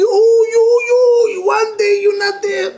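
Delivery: high, loud mock lament; mock wailing. Rabbi mocking workaholic husband on vacation, wailing. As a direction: wail it as if the company collapsed.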